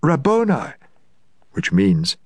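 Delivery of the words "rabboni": cried out. Acted. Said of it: "cried out in Aramaic".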